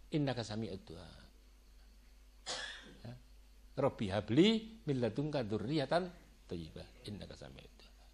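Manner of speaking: soft recitation fading to a whisper; prayer recitation. Acted. Preacher reciting the prayer, fading to a whisper. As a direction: let the prayer drop to a near whisper.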